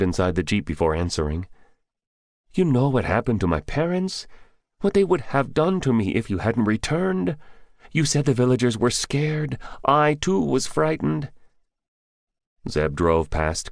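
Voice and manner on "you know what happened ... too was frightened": put-on higher young man admitting fear; higher young grocer owning his fear; young villager Bidar.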